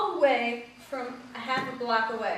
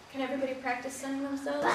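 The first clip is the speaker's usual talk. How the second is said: usual speaking voice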